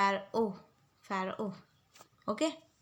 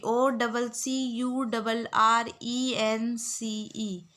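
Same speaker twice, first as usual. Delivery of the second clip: letter by letter, very slow; slow spelling out; much slower than usual. Shown as a demonstration. Teacher spelling a word slowly for the class. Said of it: "आप लोग बार बार repeat कर लो".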